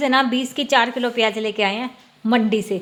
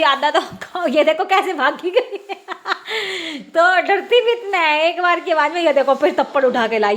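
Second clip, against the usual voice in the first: laughing; 5 semitones higher than usual. Real, not acted. Laughing as child runs off.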